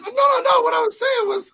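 high, loud, flustered mock backtracking; backpedalling. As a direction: imitate her scrambling to take it back. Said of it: "She was like".

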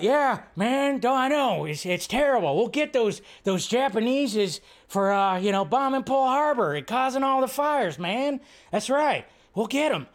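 put-on high, far-off old man voice, rambling; Biden impression. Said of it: "doing that Biden impression".

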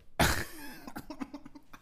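high-pitched, helpless laughter. Real, not acted. Comic cracking up at his own story, high laughter. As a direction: burst into a long high laugh.

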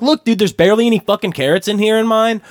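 loud, high-pitched retold complaint; angrier friend. Friend complaining about missing carrots, indignant imitation. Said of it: "starts getting angrier".

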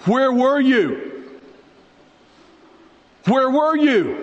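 high, drawn-out accusing question; acted reproach. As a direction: demand it slowly, pitch falling at the end. Friends reproach Jesus on arrival.

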